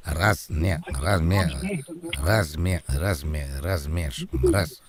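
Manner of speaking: low stuttering loop on one word; like a skipping record; mock stuck stutter. Prankster gets stuck on one word.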